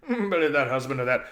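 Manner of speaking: loud, chuckling horse voice; laughing horse. Acted. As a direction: laugh at the cheated master. Bree mocks Tarquin, laughing.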